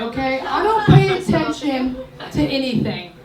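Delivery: usual speaking voice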